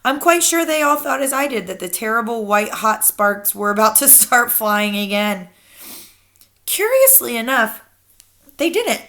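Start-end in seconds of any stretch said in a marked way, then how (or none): none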